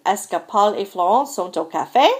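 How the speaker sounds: "café" swinging up at the end; rising question. Shown as a demonstration. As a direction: let the voice climb on "café". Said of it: "the voice goes up at the end".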